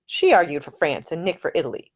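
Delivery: ordinary talk, nothing marked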